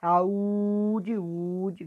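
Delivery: teacher dragging the Ú in "Saúde, ude"; stretched stressed syllable. Shown as a demonstration. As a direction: pull out the "ú" in "Saúde". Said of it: "puxando mais a sílaba forte".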